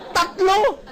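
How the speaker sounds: Tatlo thrown out high and exclaimed; emphatic exclaim. Shown as a demonstration. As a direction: sing out Tatlo like a punchline.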